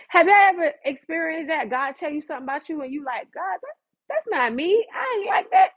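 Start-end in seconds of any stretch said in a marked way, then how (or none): 3.3-5.7 high-pitched, indignant denial; mock protest. Believer arguing with God, mock-protesting.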